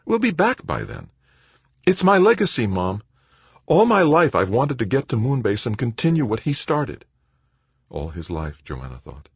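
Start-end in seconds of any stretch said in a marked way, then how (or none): 0.0-7.0 high-pitched, urgent argument; eager son pressing hard; insistent pleading. Acted. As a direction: argue your case earnestly to mother. Son argues with reluctant mother.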